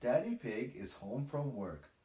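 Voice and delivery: plain reading voice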